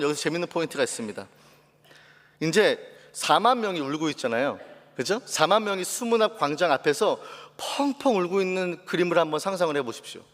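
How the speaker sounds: ordinary talk, nothing marked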